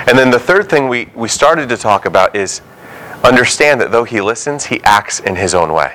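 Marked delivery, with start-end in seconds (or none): none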